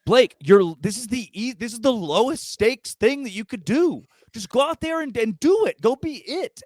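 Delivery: high, exasperated exhortation; really fired up. Real, not acted. Podcaster rants at his quarterback over an easy chance, fired up. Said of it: "I got so fired".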